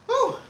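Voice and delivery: high winded exhale; breathless whew. Real, not acted. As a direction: blow out a tired whew. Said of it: "Why did I run".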